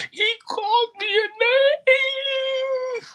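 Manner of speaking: high, slow imitated sobbing girl; crying child. A crying girl reporting a classmate, imitated wail. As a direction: wail it like a little girl in tears. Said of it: "Stop crying, Jamisha".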